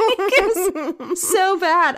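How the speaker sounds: high, loud laugh then slow laughing words. Real, not acted. Laughing at her own bagel story, to her co-host. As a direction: burst out laughing and drag it out.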